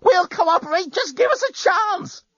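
high frantic plea; desperate pleading. Acted. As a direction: beg for your life.

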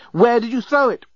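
high-pitched, frantic demand; mock yell, pitched right up; urgent yell. A narrator's reading. Journalist interrogates Leovinus about the lost piece, frantic. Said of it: "yelled the dreadful journalist".

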